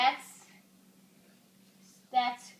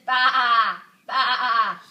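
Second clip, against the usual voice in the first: laughing baa-baa sheep noise; 3 semitones lower, 8 dB louder than usual. Shown as a demonstration. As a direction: bleat like a sheep while laughing. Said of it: "He says".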